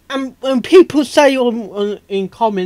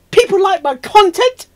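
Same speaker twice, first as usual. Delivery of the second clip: mock disbelief; 10 semitones higher, 4 dB louder than usual. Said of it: "They're thinking".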